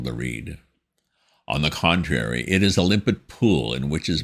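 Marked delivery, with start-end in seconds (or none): none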